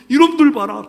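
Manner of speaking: loud, high indignant outburst; God losing patience with them; booming divine anger; angry God. Acted.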